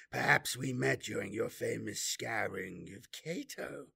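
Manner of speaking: taunting, bored demon; a sly, mocking demon voice. A narrator's reading.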